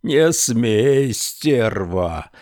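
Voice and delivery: enraged man screaming at the vanished woman; furious yell. A narrator's reading. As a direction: scream it down the street. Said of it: "вопил он на всю улицу".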